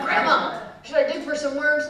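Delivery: put-on loud, high, excited child asking; eager little girl. Child asking grandma about bait, eager. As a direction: pipe up keenly, pitched high.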